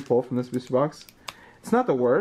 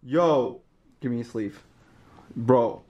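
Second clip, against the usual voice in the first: usual speaking voice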